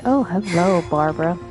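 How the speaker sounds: ordinary talk, nothing marked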